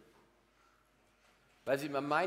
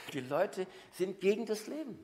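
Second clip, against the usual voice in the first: ordinary delivery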